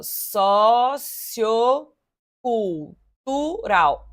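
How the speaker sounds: so-ci-o-cul-tu-ral, each syllable drawn out very slowly; split into syllables. Shown as a demonstration. Teacher counting syllables for the class, very slow and spaced. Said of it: "Um, dois, três, quatro, cinco".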